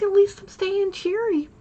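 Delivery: mock-cheery retail voice; forced cheer. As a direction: sing it out cheerfully. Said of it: "I was like".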